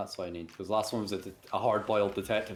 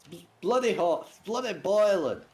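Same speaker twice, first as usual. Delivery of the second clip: warthog Len voice; 7 semitones higher, 3 dB louder than usual. Acted. Warthog complains of heat.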